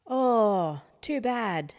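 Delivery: loud, high cry of dismay; dismayed exclamation. Acted. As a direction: gasp it out as a shocked crowd. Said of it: "everybody said".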